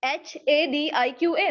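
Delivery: slow letter-by-letter "एच ए डी आई क्यू ए"; spelling out letters. Shown as a demonstration. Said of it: "मेरे नाम में कितने alphabets". (एक-एक अक्षर गिनाते हुए)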